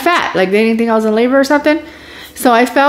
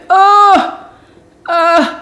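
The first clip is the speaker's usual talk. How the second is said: high, strained moans of pain; imitated pained groans; 10 semitones higher than usual. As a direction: groan as if everything is being pushed up. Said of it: "it's like the pain intense".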